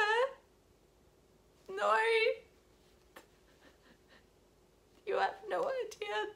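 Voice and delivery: high, anguished tearful voice, halting; real crying. Real, not acted. Wishing she could go back and warn the girl, crying. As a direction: push through sobs with long breaks. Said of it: "I'm just gonna cry".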